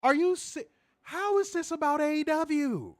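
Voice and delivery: high, slow, disbelieving; are you serious, drawn out high in disbelief; stunned exasperated disbelief; incredulous. Retold or imitated. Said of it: "I'm like".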